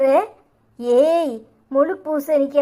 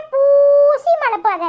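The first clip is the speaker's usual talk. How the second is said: scolding shout; 10 semitones higher, 5 dB louder, much slower than usual. Acted. Grandmother scolding granddaughter.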